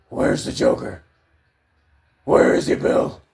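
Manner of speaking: gruff, loud, unvoiced Batman growl; raspy Batman impression.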